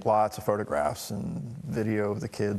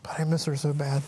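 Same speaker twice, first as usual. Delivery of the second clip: tearful near-whisper; barely getting the words out through tears; broken, whispered grief; crying; 3 semitones higher than usual. Real, not acted. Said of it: "I hate crying in public".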